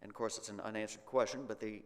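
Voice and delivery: ordinary talk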